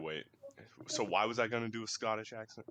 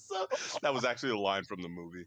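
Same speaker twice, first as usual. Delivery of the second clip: ordinary talk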